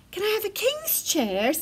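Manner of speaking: high, pleading little bear; begging. A narrator's reading. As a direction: ask beseechingly like a child. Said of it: "begged Baby Bear".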